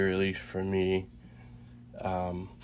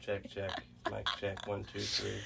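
quiet mic check; 10 dB quieter than usual. Pretend. Jokey sound check.

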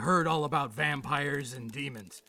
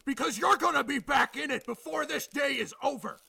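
raised, furious scolding; angry; 7 semitones higher than usual. Acted. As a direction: chew out a subordinate. Chief berates officer over escape.

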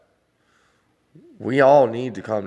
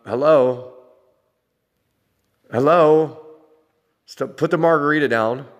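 raised, louder mock call for attention; loud, higher-pitched sarcastic hailing; exasperated calling out; 5 semitones higher, 5 dB louder than usual. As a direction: call out to someone who isn't listening. Calling out to distracted other parent.

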